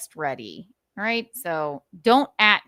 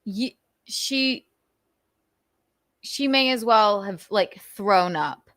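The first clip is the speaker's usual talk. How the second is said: usual speaking voice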